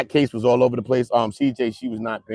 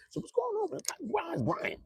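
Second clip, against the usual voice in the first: much higher, softer mock voice; high put-on voice; 15 dB quieter than usual. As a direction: ask it in a high, silly voice. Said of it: "I'm like".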